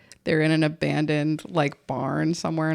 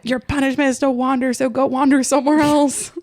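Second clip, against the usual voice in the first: higher, rising mock tirade; mock angry banishing; 7 semitones higher, 4 dB louder than usual. Woman hunter banishing Chert, mock angry scolding. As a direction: banish him with hammed-up exasperation.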